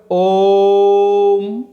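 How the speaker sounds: ओम held very long and loud, the ओ stretched out; prolonged Om. Shown as a demonstration. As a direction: draw out ओम in one long loud chanted breath. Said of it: "ओम का उच्चारण करते थे".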